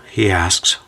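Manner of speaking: ordinary delivery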